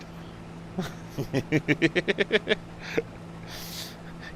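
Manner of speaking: high, airless wheezing laugh; a silent, wheezing giggle; real wheezy laughter; breathless laugh. Real, not acted. Laughing at friend's accent.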